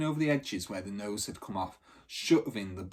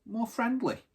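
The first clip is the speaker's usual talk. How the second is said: Bridie's voice; 11 semitones higher than usual. Acted. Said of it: "she said".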